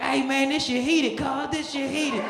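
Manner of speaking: high party voice; loving the pool; carefree Dion. Retold or imitated. Dion calls from the pool that the water's heated, high and carefree.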